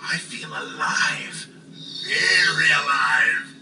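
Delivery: drawn-out, savouring each word; slow savouring. Acted. Brain relishing being alive, slow and savouring. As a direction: let it sink in, slowly.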